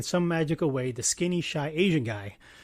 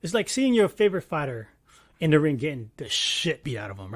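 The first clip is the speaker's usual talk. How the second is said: ordinary talk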